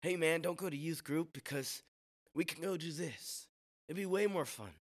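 high, light voice of a tempting pal; discouraging friend. Acted. Friend luring someone from youth group, high coaxing voice. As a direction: coax them away in a lifted voice. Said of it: "not push us further from Him".

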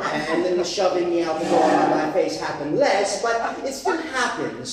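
ordinary talk

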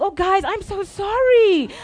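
overdone fake sorry to leave the group; mock apology. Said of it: "And then I was like".

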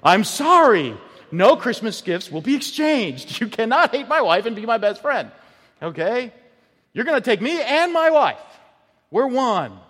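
high, loud, indignant husband with a laugh; offended husband. Retold or imitated. Preacher playing a husband telling off a friend who insults his wife, indignant. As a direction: put the friend firmly in his place.

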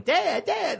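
put-on high, childlike calling of Dad, Dad; kid calling dad. Daughter waking her dad in the morning, high childish calling. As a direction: call out like a little girl waking her father. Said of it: "she's like, going".

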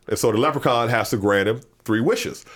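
ordinary delivery

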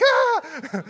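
high, loud squeal of disgust; mock horrified yelp. Preacher retelling vomit landing on his hands, mock horrified squeal. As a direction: yelp comically at the mess.

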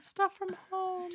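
high mock voice. Repeating as [name].

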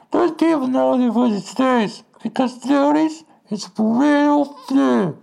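very high, distorted megaphone rant; girl on megaphone. Retold or imitated. Protest leader rallying the crowd over a megaphone, megaphone squawk. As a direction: mimic an angry protester blaring through a megaphone. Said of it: "There's some girl on a megaphone".

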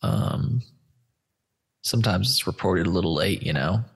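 usual speaking voice

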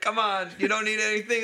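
high, loud coaxing hawker; pushy vendor. Retold or imitated. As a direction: coax the tourist. Vendor voiced in story.

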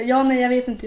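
livelier, exaggerated mimicking of indecision; amused boyfriend imitation. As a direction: parrot the clueless answer with amusement. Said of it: "brukar vara hoppas på det bästa".

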